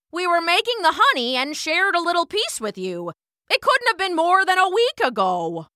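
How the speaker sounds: high, emphatic regal voice; insistent queen bee. A narrator's reading. Queen bee pressing ant to remember, insistent.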